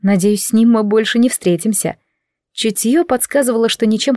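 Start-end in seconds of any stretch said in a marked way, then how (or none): none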